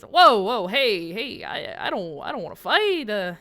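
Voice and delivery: surprised, backing off. Acted. Halfling with hands up begging not to fight, surprised. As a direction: burst out loudly, then stammer a plea. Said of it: "looks a bit surprised".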